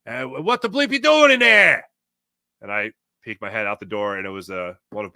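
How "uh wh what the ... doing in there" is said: loud, high-pitched Boston cop yell; thick Boston accent. Retold or imitated. Boston cop challenging an intruder, imitated thick Boston accent. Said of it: "in a very thick Boston accent".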